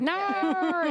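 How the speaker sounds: hammed-up Australian accent. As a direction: squeal it like an Aussie saying no. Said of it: "N A R. That's how they".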